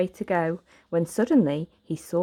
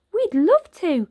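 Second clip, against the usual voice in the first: put-on high, louder young girl; bright little girl introducing herself; 12 semitones higher, 6 dB louder than usual. Girl greeting Oliver with a smile in a little girl voice.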